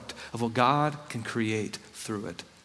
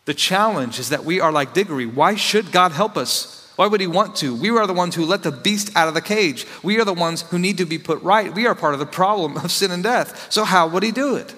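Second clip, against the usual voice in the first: higher and louder, pressing the questions home; preaching at full force; 7 semitones higher, 7 dB louder than usual. Acted. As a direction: rise into a fervent preacher's plea.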